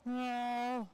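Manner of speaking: high cat voice; cat meow. Pretend. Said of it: "I do a really good cat voice".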